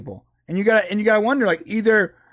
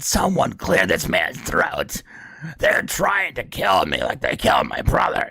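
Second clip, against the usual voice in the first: RFK impression; 13 semitones lower than usual. Mocking RFK's voice, a low throaty croak. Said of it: "so he talks like this".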